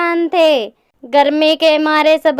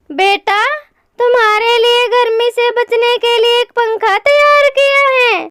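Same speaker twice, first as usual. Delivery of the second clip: higher-pitched storybook mother; reassuring mother bird; 7 semitones higher than usual. Acted. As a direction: reassure your chick in a raised character voice. (दिलासा देती माँ चिड़िया की ऊँची आवाज़)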